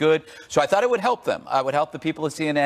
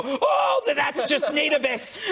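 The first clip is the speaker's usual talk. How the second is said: mock shout; 10 semitones higher than usual. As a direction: yell it like a scandalised pundit. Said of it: "They go".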